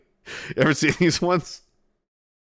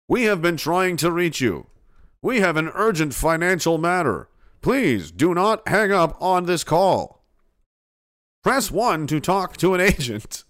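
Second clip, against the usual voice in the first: higher, measured automated-message delivery; Chinese consulate robocall; 4 semitones higher than usual. Pretend. Imitating an automated scam call about an urgent matter, robocall voice. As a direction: put on an urgent recorded-call voice. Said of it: "That sounded like the Chinese consulate".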